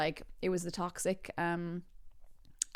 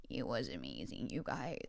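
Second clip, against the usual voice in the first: very low, hushed and creaky; hushed awe; 11 semitones lower, 12 dB quieter than usual. Real, not acted. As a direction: drop right down, almost under your breath. Low hushed repeat for effect.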